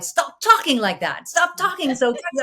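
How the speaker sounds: high, loud retold scolding; exasperated stepmother. Stepmother scolding a child over her Texas accent, mock exasperated. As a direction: imitate a stepmother going nuts. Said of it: "my stepmother was, like, going nuts".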